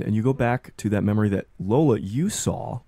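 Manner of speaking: ordinary delivery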